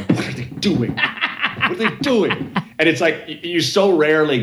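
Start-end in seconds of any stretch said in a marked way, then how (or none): 0.0-2.5 baffled onlookers. Retold or imitated. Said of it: "people crossing their arms and going".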